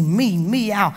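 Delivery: usual speaking voice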